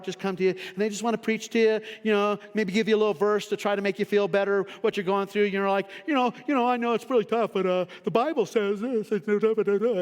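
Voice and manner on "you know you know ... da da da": lightly voiced, higher well-meaning preacher; preachy friend. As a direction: offer a Bible verse a bit too sweetly. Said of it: "going into preacher mode".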